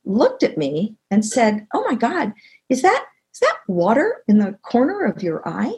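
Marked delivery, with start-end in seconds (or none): 1.7-5.7 high, incredulous questioning; astonished teammate. Retold or imitated. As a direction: imitate a colleague's disbelief, pitch swooping up. Teammate spots her tears, retold.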